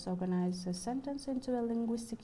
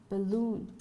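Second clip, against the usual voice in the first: "balloon" said louder, weighted on LOON; stress on last syllable; 5 dB louder than usual. Shown as a demonstration. Lecturer gives an English example of free stress, last-syllable stress in "balloon". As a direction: land firmly on LOON in "balloon". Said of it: "on the last syllable".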